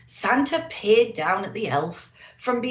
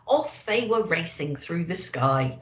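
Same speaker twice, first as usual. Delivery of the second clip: plain reading voice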